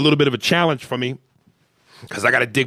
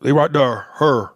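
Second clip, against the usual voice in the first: mock Southern drawled R's; Southern accent. Shown as a demonstration. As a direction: imitate a Southerner saying "here" as "her". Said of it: "Southerners, y'all say her".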